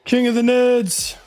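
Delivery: excited cheer. Real, not acted. Crowning the winner cheer.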